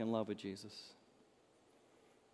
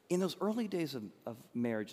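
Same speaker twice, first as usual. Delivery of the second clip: ordinary talk, nothing marked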